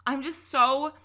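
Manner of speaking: fed-up rant rising high; exasperated rant. Real, not acted. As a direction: pitch climbing high with frustration. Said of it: "fucking tired of the narrative".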